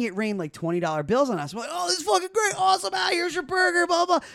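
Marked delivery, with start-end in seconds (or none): 1.7-4.3 high-pitched mock excitement; thrilled gushing. Young runners thanking a big tipper, thrilled imitation. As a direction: gush like tipped staff, voice way up.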